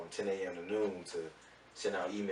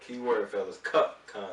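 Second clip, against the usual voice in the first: "cup" punched loud and set apart; stress on cup; 3 semitones higher, 8 dB louder, slower than usual. Real, not acted. Co-host clarifying the product to the fellas, loud emphasis on "cup". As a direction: hit "cup" hard as the keyword. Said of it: "Emphasis on the cup".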